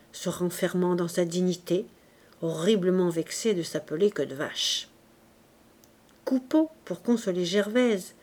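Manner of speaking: ordinary delivery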